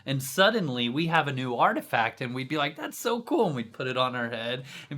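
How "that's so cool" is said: squeaky high mock delight, much higher. Gloating thief mocks his victim, high gushing voice. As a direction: gush in a silly high voice. Mocking thanks after stealing artifact.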